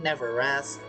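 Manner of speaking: ordinary delivery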